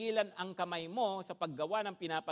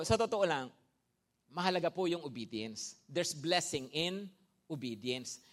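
ordinary delivery

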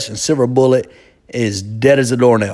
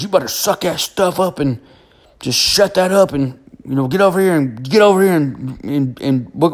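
higher put-on dad voice, barking orders; a hint of a gruff daddy telling his son to toughen up; stern father; 4 semitones higher than usual. Dad orders his crying son to quit, stern. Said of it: "my daddy walks over and goes".

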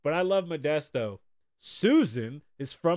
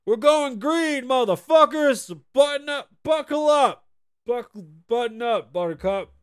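mock hype shout; 5 semitones higher, 7 dB louder than usual. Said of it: "O'Connell said".

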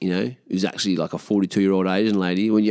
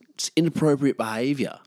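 usual speaking voice

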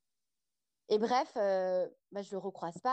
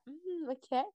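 « hmm » aigu de femme intriguée; intérêt coquin joué; 7 semitones higher than usual. Acted. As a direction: feindre d'être soudain très intéressée. Réaction jouée à « il est anglais ». (réaction jouée, intriguée et aguicheuse)

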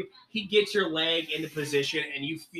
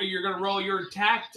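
ordinary talk, nothing marked

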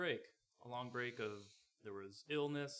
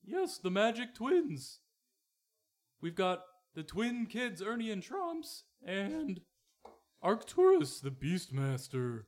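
high excited announcer; 8 semitones higher, 6 dB louder than usual. Acted. GM voices Wenselton.

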